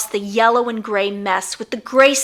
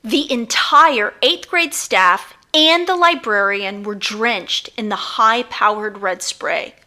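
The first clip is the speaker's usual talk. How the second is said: ordinary delivery, nothing marked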